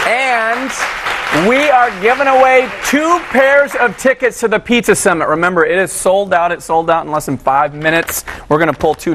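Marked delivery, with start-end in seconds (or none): 0.1-5.3 excited announcement. Real, not acted. Announcing giveaway to viewers.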